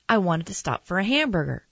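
ordinary delivery, nothing marked

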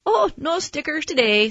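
high-pitched, cheery nurse voice; chirpy nurse. A narrator's reading. Nurse tells the boy about today's treat, chirpy. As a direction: announce a treat brightly to a child.

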